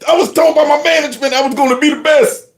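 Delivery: high, hurried whiny imitation; hammed-up fake upset; mock tearful. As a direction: mimic him pleading for sympathy. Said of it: "here he is trying his best".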